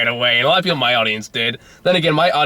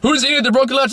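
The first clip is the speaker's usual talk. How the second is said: much higher, puzzled innkeeper voice; mock Mexican innkeeper; 13 semitones higher than usual. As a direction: play the confused innkeeper asking. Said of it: "and he goes".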